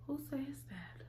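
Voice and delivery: hushed, breathless whisper of disbelief; shocked whisper. Retold or imitated. A shocked whisper to herself about her friend's story. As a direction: whisper it like you can't believe your ears.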